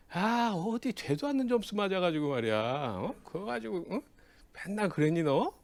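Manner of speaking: mock gloating. Mock payback on taunting friend.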